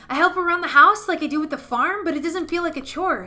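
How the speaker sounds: put-on high, bouncy little boy voice; a child eagerly sharing his news; excited scarecrow boy. Boy writes about visiting Mom at night, high and eager.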